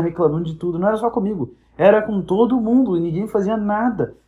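normal talking voice

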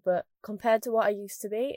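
ordinary talk

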